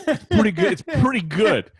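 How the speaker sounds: mock impressed. Reacting to lookalike video.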